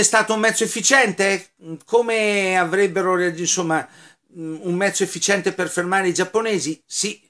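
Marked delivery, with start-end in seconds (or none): none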